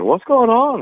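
high, loud puzzled question; retold bafflement. Tommy seeing his ticket sales jump, baffled outburst. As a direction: imitate astonished confusion, pitch jumping up.

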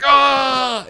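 loud, high mock yell of pain; hammed-up cry as the drill goes in; mock scream. Boyfriend reacting to the alien drill, hammed-up scream. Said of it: "he's like".

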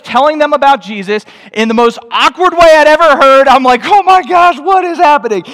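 high, loud, hammed-up panic; retold freak-out at an excruciating scene; mock horror. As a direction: build to a squealed, disbelieving outcry. Retells watching awkward evangelism in horror.

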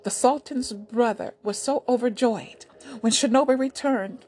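ordinary delivery, nothing marked

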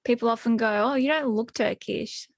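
usual speaking voice

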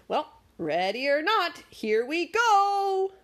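rising to a high, loud, slow cry; building from a call to a high, loud yell; excited launch. Acted. Magic launching the toboggan with Dwayne, a rising excited yell.

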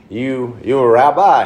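mock Southern drawl; Southern drawl quote. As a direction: imitate the Southern stranger. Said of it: "my, like, first Southern experience".

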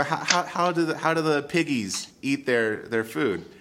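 high, sing-song mom asking; mother voice. Retold or imitated. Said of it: "she tells Ralphie".

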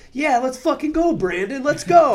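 high, loud hyped-up Boston guys; imitated rowdy yelling. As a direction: cheer him on like a rowdy crowd. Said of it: "yelling this on the plane".

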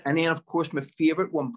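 ordinary talk, nothing marked

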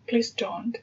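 normal talking voice

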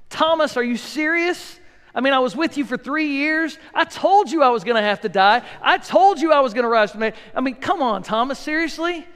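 Jesus as the impatient teacher he never was, exasperated; exasperated scolding. Pretend. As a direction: scold a friend who should know better. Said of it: "that's not what Jesus said".

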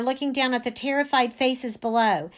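ordinary delivery, nothing marked

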